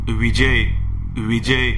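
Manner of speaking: possessed woman speaking as a man; a hint of a male spirit's voice; lightly voiced male ghost through her; man's voice. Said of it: "Vishakha पुरुषाच्या आवाजात बोलली".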